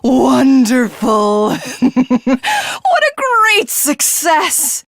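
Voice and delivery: slow, high, laughing triumph; villain cackling over his success; gloating laughter. Acted. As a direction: savour the victory through laughter. Villain celebrates destroyed ship.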